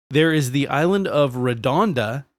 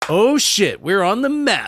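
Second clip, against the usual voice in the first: mock excitement; 7 semitones higher, 3 dB louder than usual. Voicing townsfolk thrilled by post office.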